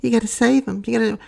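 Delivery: high-pitched mocking imitation of an aunt; mock do-gooder falsetto; Aunt May. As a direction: put on a high, pleading old-aunt voice. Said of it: "And Aunt May's like".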